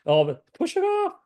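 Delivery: high squeaky mock of a boss. Mocking boss's dismissive reply.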